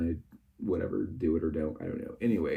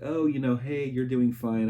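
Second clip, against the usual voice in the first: reassuring quote; 4 semitones higher, 5 dB louder than usual. Retold or imitated. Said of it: "vice president's like".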